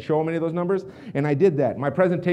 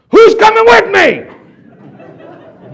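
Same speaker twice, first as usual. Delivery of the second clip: huge high drawn-out rallying yell; sudden yell; 17 dB louder, much slower than usual. Retold or imitated. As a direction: bellow it out of nowhere, imitating a man rallying the room. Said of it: "He yells it like that".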